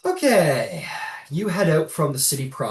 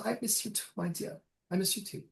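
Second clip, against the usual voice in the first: high, soft motherly farewell; tender mother; 8 semitones higher, 10 dB quieter, faster than usual. Acted. Old mother bidding daughter goodbye, soft high voice. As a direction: answer softly and lovingly, high voice. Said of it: "And he goes".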